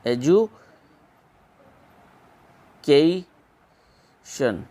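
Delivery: एजुकेशन very slowly as "ed-ju... kay... shun"; long gaps between the parts of एजुकेशन; syllable by syllable. Shown as a demonstration. Teacher sounds it out while writing it, syllable by syllable. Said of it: "कैसे लिखा जाता है".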